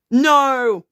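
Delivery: sharp high protest, loud; loud shocked no. Real, not acted. Player objecting to the game result, loud shocked no. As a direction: cry out in dismay.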